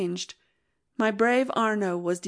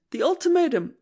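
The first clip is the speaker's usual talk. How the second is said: excited cry; 7 semitones higher, 4 dB louder than usual. Acted. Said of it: "he cried, all out of breath".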